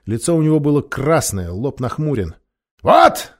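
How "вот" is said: loud furious bark, pitched high; barked shout. A narrator's reading. King Miraz tossing the letter across the table, angry shouted bark. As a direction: bark it out in anger. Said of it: "рявкнул он".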